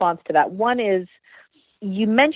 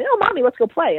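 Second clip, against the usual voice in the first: high-pitched imitation of a child; lightly put-on eager kid voice; happy child; 8 semitones higher than usual. Child asks mommy to play after calming down, happy high voice.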